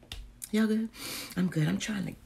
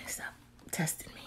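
hushed whisper; whispered retort; 16 dB quieter than usual. Real, not acted. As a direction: whisper back at the teaser. Whispered reply to off-mic teasing.

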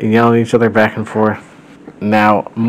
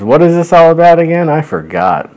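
higher, louder puzzled question; mild acted confusion, pitched up; lightly voiced Morton; 8 semitones higher, 4 dB louder than usual. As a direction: act out a forgetful character, lightly. Acting Morton in read-aloud story.

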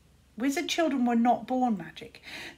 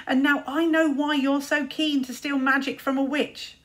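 louder, higher, cruel taunt; 5 semitones higher, 7 dB louder than usual. Acted. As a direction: rub his face in it. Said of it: "taunted Luther".

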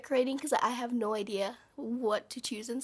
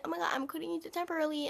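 rushed mock announcement; 5 semitones higher, much faster than usual. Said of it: "if I say".

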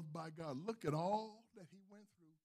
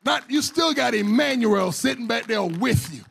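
preaching at full force; 3 semitones higher, 16 dB louder than usual. Acted. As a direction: proclaim it to the church. Final climax, shouting the list.

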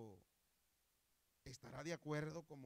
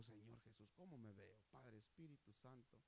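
very soft, lowered voice; quiet, intimate asking; hushed prayer; 4 semitones lower, 11 dB quieter than usual. Retold or imitated. Preacher asks the Lord at the mirror, hushed.

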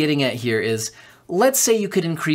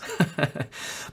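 quiet little laugh to yourself; chuckle; 7 dB quieter than usual. Real, not acted. Host amused by own speculation on subscriptions, soft chuckle.

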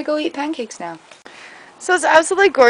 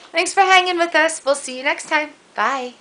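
normal talking voice

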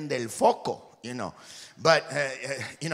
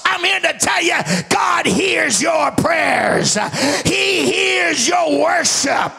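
loud, high, slow preacher's cry; preaching at full force; 12 semitones higher, 10 dB louder, slower than usual. Real, not acted. Preacher roaring to his congregation, loud and high. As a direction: shout it out to the church.

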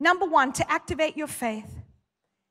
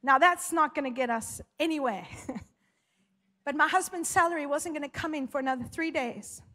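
ordinary talk

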